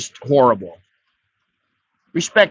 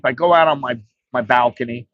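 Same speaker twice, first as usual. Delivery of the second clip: ordinary delivery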